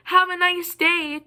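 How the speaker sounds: high, drawn-out fake pleasantry; sugary-nasty mock politeness, high and stretched. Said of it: "in the nastiest voice possible".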